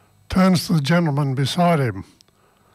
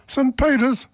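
"Saint Peter's?" jumps higher in amazed disbelief; amazed echo of "Saint Peter's?", rising higher; surprised; 10 semitones higher, slower than usual. Acted. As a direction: echo "Saint Peter's?" as a delighted question, pitch leaping up. Joke: second Irishman amazed.

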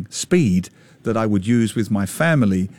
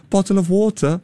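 higher-pitched mock Harry Potter British accent; put-on British accent; 7 semitones higher than usual. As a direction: mimic a student faking a British accent. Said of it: "auditioning you for Harry Potter".

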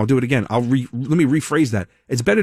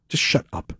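whispered repeat; 5 dB quieter than usual. Real, not acted. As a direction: whisper it, no voice at all. Repeats it, breathier.

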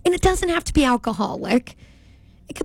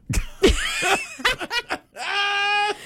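high shrieking burst of laughter; 9 semitones higher than usual. Real, not acted. Reveals the mold, loses bet.

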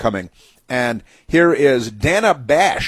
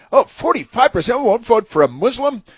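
high-pitched, put-on disbelief; exaggerated astonished question, pitched way up; mock shock; 10 semitones higher than usual. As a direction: imitate a scandalized reaction. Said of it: "Why isn't she astonished and shocked".

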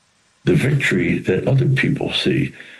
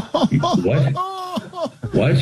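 mock startled double take, laughing; startled what. Telling the sound man he missed it, laughing in disbelief.

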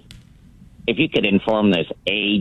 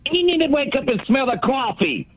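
much higher, strange put-on voice; mock taunt. Caller taunts the rival caller in a much higher put-on voice. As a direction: fling the line way up high to mock him.

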